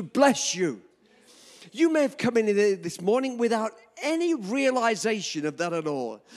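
slow, loud, emphatic pulpit preaching; fervent preaching. Acted. Pastor preaching to his congregation, loud and fervent. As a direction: proclaim God's blessing to the whole room.

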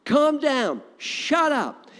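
high, loud rebuke, each phrase falling; rebuking shout. Acted. Jesus silencing the devil, loud high rebuke. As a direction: slam each command down. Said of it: "He was saying that to the devil".

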